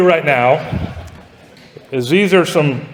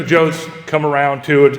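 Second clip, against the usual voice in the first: ordinary delivery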